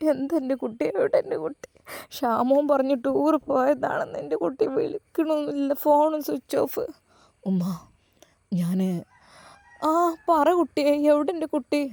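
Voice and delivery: put-on tearful old mother. Mother pleads with son.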